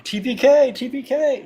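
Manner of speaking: high, loud gleeful chant of TPK; gleeful shout. Pretend. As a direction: gloat it out loud like a villain GM. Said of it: "No, I'm kidding".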